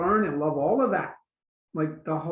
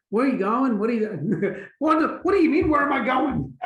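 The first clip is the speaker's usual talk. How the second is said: raised, defensive pitch climbing higher; irritated questioning; 6 semitones higher, 5 dB louder than usual. Retold or imitated. Answering a partner's question with annoyed disbelief. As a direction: snap back at being questioned. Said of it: "I just".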